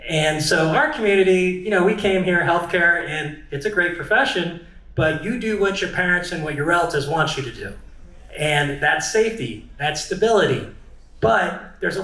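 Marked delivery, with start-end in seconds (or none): none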